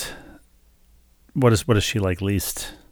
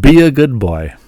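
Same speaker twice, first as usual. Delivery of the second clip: loud, higher, firm mock command; imitating bossy lady; 4 semitones higher, 10 dB louder than usual. Husband quotes parting lady to wife, loud imitated command. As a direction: repeat her order loudly for effect. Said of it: "That's exactly what she said".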